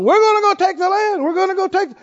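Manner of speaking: high, loud mock bravado; mocking the people. Preacher mocks Israel's boast to take the land, high and loud. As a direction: ham up their rash boasting. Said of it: "here they are going".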